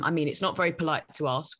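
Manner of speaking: normal talking voice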